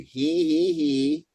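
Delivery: high, measured "he, he, he" drill; vocal exercise. Shown as a demonstration. Coach saying repeat after me, high demonstrative drill. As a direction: pitch each "he" up, evenly spaced. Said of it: "Repeat after me".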